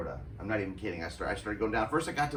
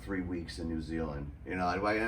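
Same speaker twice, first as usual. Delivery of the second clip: ordinary delivery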